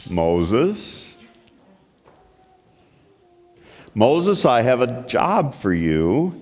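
a hint of a deep divine voice; voice of God. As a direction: call him gently from the burning bush. God speaks from the bush.

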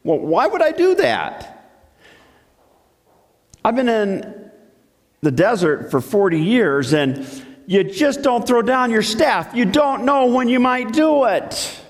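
can't believe he's asked; lightly voiced baffled shepherd; incredulous Moses. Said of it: "Moses said".

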